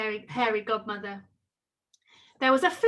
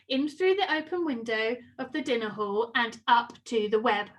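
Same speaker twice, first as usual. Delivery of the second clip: usual speaking voice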